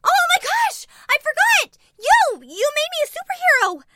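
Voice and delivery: squealing with sudden delight; high excited teen voice. A narrator's reading. Girl remembers she's a superhero.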